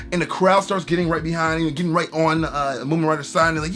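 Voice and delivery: normal talking voice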